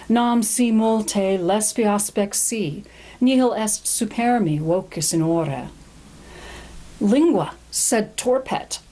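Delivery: slow, measured Latin recitation; poem reading. Shown as a demonstration. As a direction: read the Latin verse aloud with weight. Reads the Latin poem aloud.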